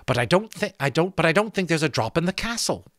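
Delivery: Molly nervously excusing herself; nervous, stumbling. A narrator's reading. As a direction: stumble over the words, nervous. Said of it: "she said nervously".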